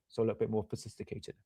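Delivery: talking around a pen held in the mouth; pen in teeth. Real, not acted. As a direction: mumble through a pen between the teeth. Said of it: "I'm gonna stick the pen in my mouth".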